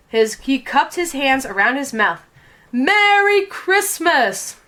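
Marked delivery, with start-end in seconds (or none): 2.7-4.6 slow, high hollered greeting; the conductor's slow high shout. A narrator's reading. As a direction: call it out over the moving train. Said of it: "he shouted".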